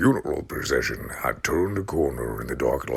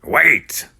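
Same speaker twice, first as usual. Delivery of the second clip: cautioning call; 13 semitones higher, 10 dB louder than usual. Acted. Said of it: "he cautioned Tom".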